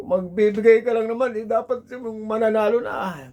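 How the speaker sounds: high, loud furious complaint; angry cursing. Retold or imitated. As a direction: yell at the spirit for the wrong number. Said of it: "Pinagmumura nung kumpare ko".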